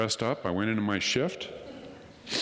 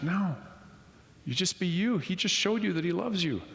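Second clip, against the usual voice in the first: a hint of a gentle older woman, pitched up; lightly voiced kind lady, higher; 10 semitones higher than usual. Lady at the restaurant reassuring him, mild higher voice.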